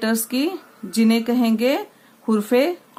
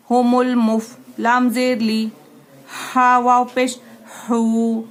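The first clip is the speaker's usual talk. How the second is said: syllable drill; slower than usual. Shown as a demonstration. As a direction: sound out each part, then join it. Spelling out syllables for class.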